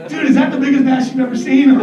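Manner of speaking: imitated boyish excitement; gushing amazement. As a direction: act out a proud angler marvelling. Friend gushes over his fish.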